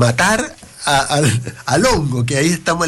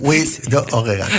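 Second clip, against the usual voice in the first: imitating French; faster than usual. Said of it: "¿cómo sería en francés aceite de orégano?".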